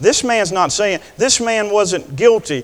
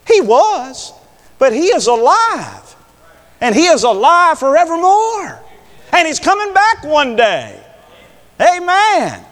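high, ringing proclamation in short bursts; triumphant preaching; 11 semitones higher, 5 dB louder than usual. Real, not acted. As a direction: proclaim it to the whole congregation. Proclaims resurrection at full force.

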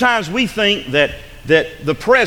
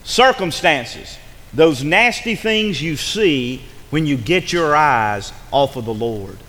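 slower, deliberate dictation pace; slower repeat; 4 semitones lower, slower than usual. Shown as a demonstration. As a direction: say it again slowly so they catch every word. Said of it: "Let me say that again".

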